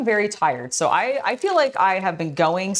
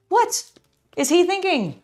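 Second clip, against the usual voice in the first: high, squeaky mock indignation; a hammed-up gasp of disbelief; mock outrage; 12 semitones higher than usual. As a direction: act scandalised on his behalf.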